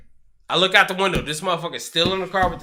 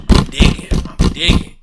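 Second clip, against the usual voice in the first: breathy secretive whisper; mock whisper; 2 semitones lower, 10 dB louder than usual. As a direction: hush it like you're hiding. Whispered retelling.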